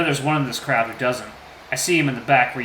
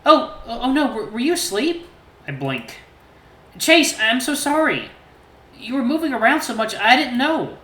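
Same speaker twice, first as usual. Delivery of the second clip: gentle T J voice; 12 semitones higher, 5 dB louder than usual. A narrator's reading. T J asks about the oatmeal in his high voice. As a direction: voice the friendly lynx lightly. T J apologising.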